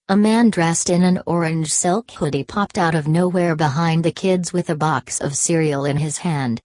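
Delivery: ordinary delivery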